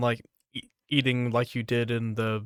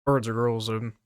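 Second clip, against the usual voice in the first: ordinary talk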